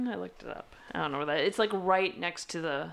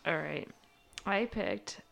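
normal talking voice

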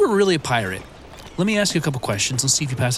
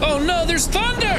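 mock panic; 13 semitones higher than usual. Panic in the storm.

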